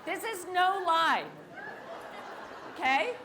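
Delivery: hammering home it's no lie; emphatic. Real, not acted. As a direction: stress the word no, loud. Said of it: "insists to the audience".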